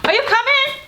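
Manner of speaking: high call to another room. Real, not acted. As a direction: call out to someone far off. Calling child from another room.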